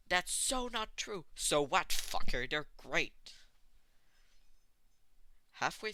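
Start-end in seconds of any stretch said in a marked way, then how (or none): 0.1-3.3 loud, high indignant outburst; indignant protest. A narrator's reading. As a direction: shout back, offended.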